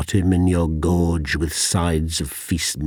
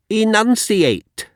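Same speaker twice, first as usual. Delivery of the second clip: shrill, much higher, slow and drawn out; teacher's command; 7 dB louder than usual. Retold or imitated. Schoolmaster commanding his pupil, drawn out and high. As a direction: bark it like a snobbish schoolmaster.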